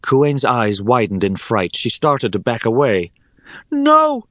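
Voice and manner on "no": high, loud cry of protest; alarmed cry. A narrator's reading. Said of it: "cried Rhea".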